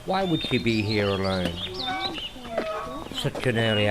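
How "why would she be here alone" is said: ordinary delivery, nothing marked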